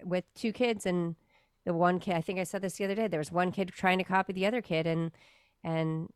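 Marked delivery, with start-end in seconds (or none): none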